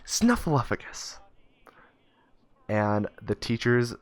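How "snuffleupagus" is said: high, slow, proud announcement; proud boy. Retold or imitated. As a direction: draw the big word out proudly as a kid. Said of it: "he says real proudly".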